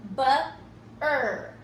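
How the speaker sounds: "bu-er" as two separate sounds, buh, er; sounded out. Shown as a demonstration. Teacher counting sounds for pupils, "bu-er" sounded out. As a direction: isolate each sound in "bu-er" with a pause. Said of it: "count the sounds in each syllable".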